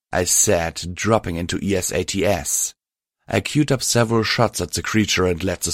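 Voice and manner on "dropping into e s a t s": ordinary delivery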